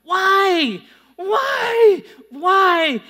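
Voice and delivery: high, loud, desperate yells; screaming coach. Retold or imitated. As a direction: scream it at the top of your lungs. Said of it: "he screams ... at the top of his lungs".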